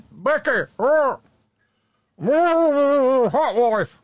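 very high, loud shouted catchphrase; calling out. Acted. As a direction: yell each word like a game-show holler.

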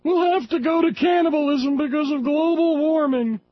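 high, loud mocking impression of a blustering pundit; mocking Glenn Beck. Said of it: "mocking her".

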